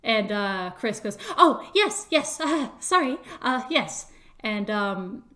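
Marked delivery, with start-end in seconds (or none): 1.4-4.1 loud high start, dropping as it stumbles; startled, apologetic fumbling; flustered. Acted. Chris caught by the official, flustered and apologetic.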